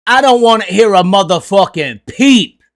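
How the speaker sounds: normal talking voice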